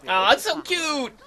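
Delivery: high-pitched adoring aww; melting with delight, pitch lifted; cooing. Real, not acted. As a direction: gush over something adorable. Reacting to witch's cute familiar.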